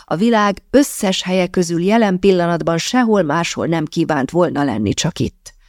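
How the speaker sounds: ordinary delivery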